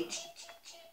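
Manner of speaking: tiny, high, soft cheeps; squeaky little chick call; chick cheeping. Acted. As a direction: peep softly like a baby chick. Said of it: "what do they say".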